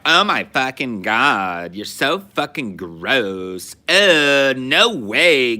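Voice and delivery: put-on high, loud, slow drawn-out Valley Girl whine; mock Valley Girl. Mocking high school Valley Girls for the co-host, loud Valley Girl drawl. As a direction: ham up a disgusted Valley Girl. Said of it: "But they were like".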